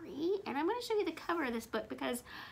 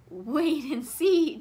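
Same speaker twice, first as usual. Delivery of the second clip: chuckling; 2 semitones higher, 8 dB louder, slower than usual. A narrator's reading. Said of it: "chuckled Elmer".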